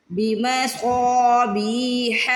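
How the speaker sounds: بمصابیح with a heavy, stretched saad. Shown as a demonstration. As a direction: recite بمصابیح very slowly, each long vowel drawn out. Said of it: "صاد کو موٹا بھی پڑھنا ہے". (بمصابیح میں صاد کو موٹا اور کھینچ کر)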